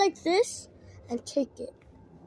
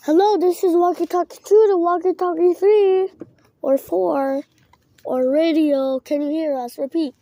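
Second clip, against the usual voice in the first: loud, formal walkie-talkie call, louder; radio operator call; 9 dB louder than usual. Pretend. Kid calling other walkie-talkies on the radio, loud and formal. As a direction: announce it like a radio operator over the air.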